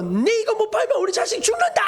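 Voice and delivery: very high, frantic acted yelling; desperate defiance. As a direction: fight back as if life depends on it. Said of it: "막 목숨 걸고 대든다는 거예요".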